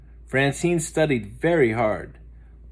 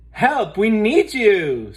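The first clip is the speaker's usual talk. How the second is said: high, loud, slow begging; panicked girl begging; urgent plea; 10 semitones higher, 7 dB louder, slower than usual. Acted. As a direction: plead for help. Muffy urgently begs.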